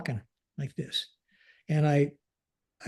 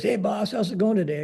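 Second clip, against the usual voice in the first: higher-pitched hello to the boss; cheery greeting; 6 semitones higher than usual. Retold or imitated. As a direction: imitate an upbeat deputy greeting the commander. Deputy greets his boss.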